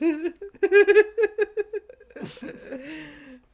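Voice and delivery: high, loud burst of laughter; cracking up helplessly; real loud laughing fit. Real, not acted. Big laugh at joke.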